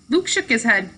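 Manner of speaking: ordinary delivery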